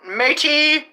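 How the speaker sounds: put-on Irish accent, sounding Australian; Irish accent that drifts toward Australian; attempted Irish accent. Shown as a demonstration. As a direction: try a jokey Irish accent and miss. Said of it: "Here's my Irish accent".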